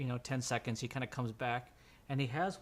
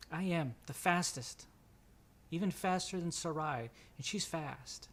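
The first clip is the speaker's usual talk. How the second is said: put-on high boyish voice, easing down at the end; young boy; 4 semitones higher than usual. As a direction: brag as a small boy. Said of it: "the little kid".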